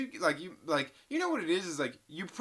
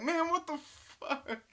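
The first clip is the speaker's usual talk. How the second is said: high, mock-outraged lead voice; lightly squeaky imitation of an annoyed coworker; imitating the lead; 14 semitones higher than usual. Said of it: "our lead stands up like".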